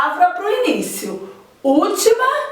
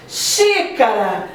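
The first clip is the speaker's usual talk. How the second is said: Xícara said slowly with a long, strong xi; slow, lower-pitched demo of Xícara, leaning on xi; stress on XI; 4 semitones lower, slower than usual. Shown as a demonstration. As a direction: stretch the first syllable xi of Xícara. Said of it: "Xi é a sílaba tônica".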